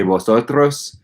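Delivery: Spanish with a Castilian accent; Castilian Spanish pronunciation. Shown as a demonstration. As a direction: say it as they would in Spain, in Castilian. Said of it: "I would pronounce differently".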